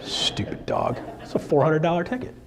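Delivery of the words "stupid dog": breathy laughing whisper; laughing whispered jab; whispered aside. Real, not acted. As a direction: mutter it under your breath with a laugh.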